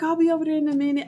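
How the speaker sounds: high, quick, careless friend voice; dismissive friend. Retold or imitated. Friend still flirting, dismissing her plea in a high voice. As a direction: brush it off lightly, up high. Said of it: "She's like".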